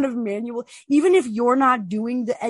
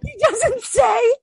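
high, loud, slow, through laughter; laughing; 12 semitones higher, 5 dB louder, much slower than usual. Real, not acted. Host describing silent live watches, helpless laughing. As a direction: squeeze the words out while cracking up. Cracking up over silent streams.

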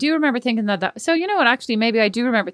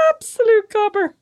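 laughing; 8 semitones higher, 4 dB louder than usual. Real, not acted. Laughing after impression.

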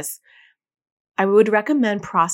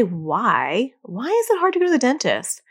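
accusatory why; 3 semitones higher than usual. Shown as a demonstration. Parent grilling a child about the dentist, accusatory. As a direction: demand the reason, putting the child on the spot. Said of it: "Even if you don't say it like that".

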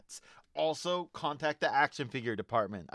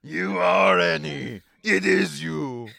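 loud, slow Watto; 3 semitones higher, 11 dB louder, slower than usual. Acted. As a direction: drawl it out as Watto. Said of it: "that was going full Watto there".

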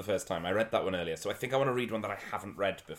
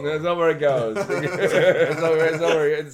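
giggling through the line, higher and louder; laughing; 6 semitones higher, 10 dB louder than usual. Acted. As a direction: laugh it out in a high voice.

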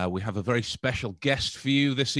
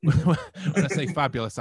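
camp fabulous; 3 semitones higher, 4 dB louder, slower than usual. Shown as a demonstration. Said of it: "I mean fabulous".

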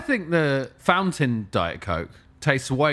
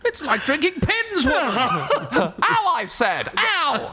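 shrill laughing royal whine; spoiled boy king; 14 semitones higher, much slower than usual. Pretend. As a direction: whine like a pampered prince. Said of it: "speaking like a real boy king".

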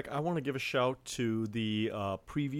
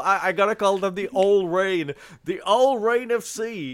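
hammed-up Southern accent, louder and higher; mock Southern accent; 8 semitones higher, 11 dB louder than usual. Podcast host teasing the team name, mock Southern accent. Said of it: "in some sort of mock Southern accent".

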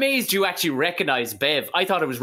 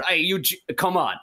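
usual speaking voice

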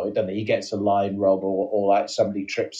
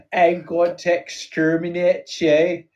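higher, slow Dalek chant with Northern Irish vowels; comic Northern Irish Dalek, drawn-out syllables; 6 semitones higher, slower than usual. Acted. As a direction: chant it like a robot from Belfast. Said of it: "do my Northern Irish Dalek".

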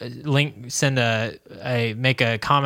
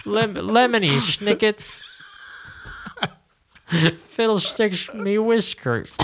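bad British accent; 6 semitones higher than usual. Podcaster does his best bad British accent. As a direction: play a silly old British gent. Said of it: "That's my best".

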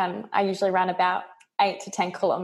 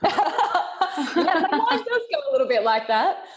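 high, laughing admission; 9 semitones higher than usual. Real, not acted. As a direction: say it through real giggles. Laughs at rabbit hole question.

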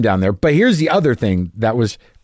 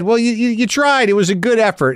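lightly voiced, higher folksy coach; imitating the coach; 10 semitones higher than usual. Little League coach consoles the grown comedian, in a mock folksy voice. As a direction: pat the kid on the back as an old coach. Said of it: "And he goes, he basically said".

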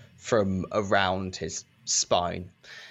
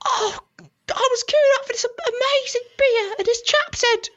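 very high, weak injured voice; injured chap voice; 4 dB louder than usual. Acted. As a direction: play the dazed victim. NPC chap voice.

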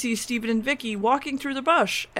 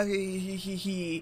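monk's mock laugh; 8 dB quieter, slower than usual. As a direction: imitate a villain's staged laugh. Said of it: "traps him and laughs".

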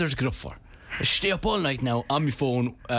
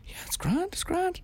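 high, soft it's grand; telling friends the early night is fine, high soft voice; high quiet reassurance; 5 dB quieter than usual. Retold or imitated. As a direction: brush it off sweetly up high. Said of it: "You say, I go".

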